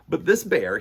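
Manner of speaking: ordinary delivery, nothing marked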